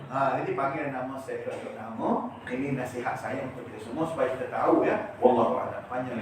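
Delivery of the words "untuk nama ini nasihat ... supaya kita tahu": normal talking voice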